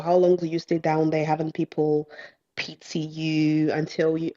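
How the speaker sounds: ordinary delivery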